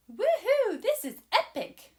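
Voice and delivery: high, loud cheering; joyful whoop. Acted. Hazel sliding down the log, whooping. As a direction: whoop like flying down a slide.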